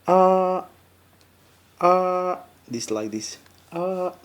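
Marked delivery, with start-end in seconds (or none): 0.1-2.4 "ah" held high and level; first tone. Shown as a demonstration. Teacher demonstrating the Mandarin first tone, high and level. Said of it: "the first tone pronounced like". 3.7-4.1 "ah" held high and level; one steady high "ah"; first tone. Shown as a demonstration. Teacher repeating the first tone to learners, high and level. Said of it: "the first tone pronounced like".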